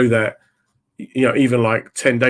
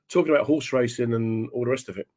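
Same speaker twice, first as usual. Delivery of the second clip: ordinary delivery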